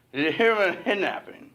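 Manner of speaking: high, loud, garbled cashier voice; mumbling cashier. Retold or imitated. Cashier repeating his question to the couple, high and garbled. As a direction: mumble it so nobody understands. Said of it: "I cannot understand a word this guy's saying".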